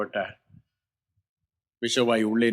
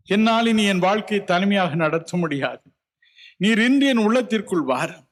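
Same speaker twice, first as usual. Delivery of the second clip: tearful prayer; 8 semitones higher than usual. Shown as a demonstration. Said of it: "கண்ணீரோடு கதறுவீர்களா".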